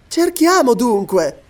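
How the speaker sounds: lady's high, insistent exclamation; eager exclamation. A narrator's reading. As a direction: urge them on, pitched high and drawn out. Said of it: "esclamò Lady Elena".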